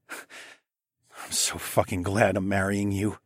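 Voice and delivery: slow and soft, full of love; tender. A narrator's reading. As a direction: say it slowly, softly. Tender line to fiancée.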